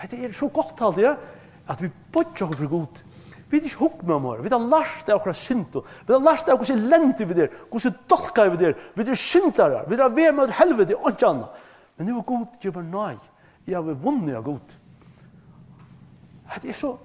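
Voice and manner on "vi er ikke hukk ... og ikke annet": loud, high, driving sermon; fervent preaching. Acted. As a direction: proclaim our sinfulness with full force. Preaches sinfulness at full force. (glödande predikan, högt och kraftfullt)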